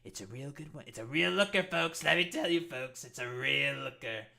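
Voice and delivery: hammed-up Trump rally voice; Trump impression. Mocking Trump while talking politics, rally-speech voice.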